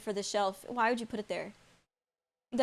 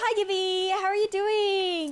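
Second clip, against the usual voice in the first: higher, bright call to a friend; cheery greeting; 6 semitones higher, 3 dB louder than usual. Real, not acted. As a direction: greet him like you are glad to see him. Greeting a chatter on stream.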